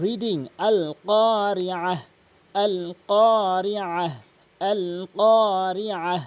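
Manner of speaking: আল কারিআহ drawn out slowly, held vowels; model Quranic reading. Shown as a demonstration. Teacher demonstrates correct reading of আল কারিআহ slowly. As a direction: stretch each syllable of আল কারিআহ slowly and loud. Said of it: "এভাবে উচ্চারণ করবেন".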